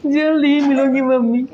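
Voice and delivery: "जल्द ही मिलूंगी mummy" as a high, mock-sobbing farewell; mock tearful goodbye. Shown as a demonstration. Teacher shows a bride saying goodbye to her mother, mock crying. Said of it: "तो क्या रो रो के बोलेगी".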